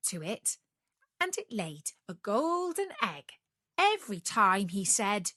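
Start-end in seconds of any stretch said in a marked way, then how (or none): none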